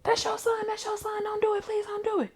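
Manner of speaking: high, pleading woman's cry; pleading Tasha. Acted. Tasha begs Cap to spare her son, high pleading cry. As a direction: beg him, voice way up high. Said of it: "here come Tasha".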